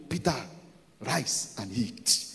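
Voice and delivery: low, soft, slow heavenly command. Acted. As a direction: give the first command quietly and slowly. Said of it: "a voice accompanied it to".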